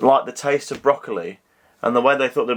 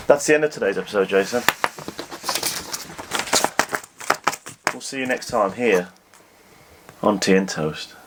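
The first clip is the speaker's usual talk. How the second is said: proper presenter; 5 semitones lower, 3 dB quieter than usual. Shown as a demonstration. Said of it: "let's do it properly then".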